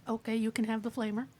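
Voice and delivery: ordinary talk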